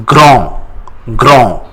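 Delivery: grand ending on a nasal vowel, no D; silent D. Shown as a demonstration. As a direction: say "grand" the French way, dropping the D. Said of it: "We don't pronounce D at the end".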